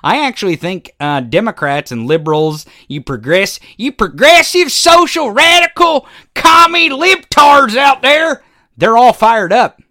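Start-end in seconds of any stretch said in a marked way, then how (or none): 3.8-9.8 mocking rant. Real, not acted.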